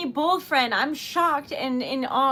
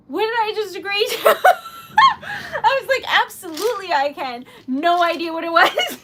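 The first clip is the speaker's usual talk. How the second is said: higher, louder, breaking into laughter; laughing disbelief; 4 semitones higher, 8 dB louder than usual. Real, not acted. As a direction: laugh helplessly at your own muddle. Laughing at her own forgotten promise.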